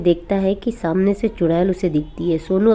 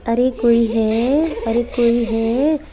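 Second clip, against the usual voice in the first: higher, slow, drawn-out witch call at the door; a witch calling sweetly at the door at night, higher and slow; 4 semitones higher, much slower than usual. Acted. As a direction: lure him out with a sing-song witch call. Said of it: "तो वो आवाज़ लगाने लगती है".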